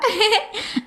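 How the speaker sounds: high jeering laugh; mocking laugh. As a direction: laugh at his failure.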